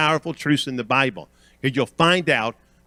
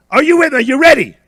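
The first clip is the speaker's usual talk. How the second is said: high, loud preacher's call; punchy pulpit challenge; rallying the congregation; 12 semitones higher, 10 dB louder, faster than usual. Real, not acted. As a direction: fire up the crowd. Calls congregation to respond.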